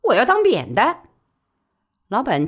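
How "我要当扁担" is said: higher, louder, quick working woman; brisk high-pitched working woman pleading her case; Li Baoli's voice. Acted. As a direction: press her case fast and eager. Li Baoli answers.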